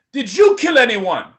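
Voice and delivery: put-on loud Nigerian accent, probing. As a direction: ask it in a firm Nigerian accent. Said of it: "another Nigerian accent".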